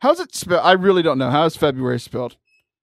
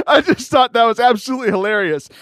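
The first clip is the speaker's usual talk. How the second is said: ordinary talk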